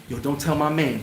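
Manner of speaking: low, softened plea for secrecy; confiding aside. Retold or imitated. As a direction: quietly imitate a guy asking for secrecy. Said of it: "You pull me to the side".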